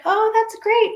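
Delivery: high, bright-eyed "Oh, that's great"; replaying her own happy surprise at the call; thrilled. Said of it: "and I was like".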